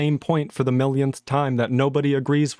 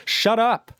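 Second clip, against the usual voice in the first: higher, sharp shut up; exasperated snap; 7 semitones higher than usual. Real, not acted. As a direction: cut them off, fed up. Snaps after mock quotes.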